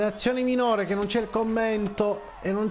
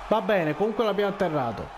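ordinary delivery

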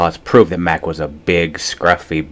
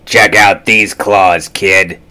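rasping; 4 dB louder, much slower than usual. A narrator's reading.

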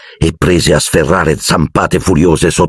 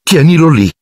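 higher-pitched irritated order; 10 semitones higher than usual. A narrator's reading. Ron telling Hermione to control her cat, irritated. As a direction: snap it in annoyance. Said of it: "disse Ron irritato".

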